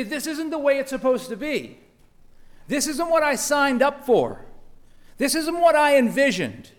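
acted-out anger at life's letdowns; angry. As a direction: protest each point with mounting grievance. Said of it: "we find ourselves angry".

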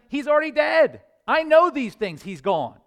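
loud, high, forceful proclamation; Jesus declaring. Acted. Jesus telling his disciples Lazarus is dead, loud forceful declaration. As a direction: announce it like a prophet with certainty.

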